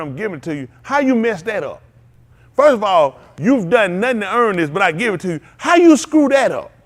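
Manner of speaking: loud, high preaching climax; rising to a fervent shout at the congregation; preaching at full force. Acted. Preacher scolding the congregation for wasting grace, fervently.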